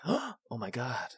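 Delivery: sharp gasp, then soft, slow awe; gasped, drawn-out mock awe; awed Deadpool gasp. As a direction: imitate a hushed starstruck reaction. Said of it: "you can hear Deadpool go".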